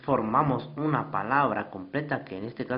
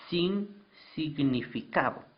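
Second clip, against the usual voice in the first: sin significado, slow and weighted on sin; stress on sin; 3 dB quieter, slower than usual. Shown as a demonstration. Teacher stressing sin for the class. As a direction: lean on sin to mark the key point. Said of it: "aquí está la clave".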